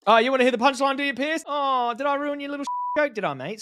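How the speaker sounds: high, loud sing-song gloating; mocking taunt. Real, not acted. Commentator gloating at the host whose joke he spoiled, high and mocking. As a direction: rub it in, sweetly mocking.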